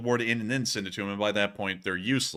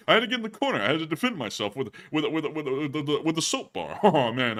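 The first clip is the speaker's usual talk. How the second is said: Rodney voice; 4 dB louder than usual. Acted. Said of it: "that Rodney voice".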